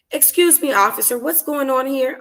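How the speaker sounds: sweet customer-service voice for the cop; professional receptionist voice. Acted. Narrator to the officer, in her receptionist voice. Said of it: "in my professional receptionist voice".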